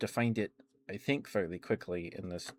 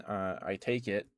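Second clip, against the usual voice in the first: ordinary talk, nothing marked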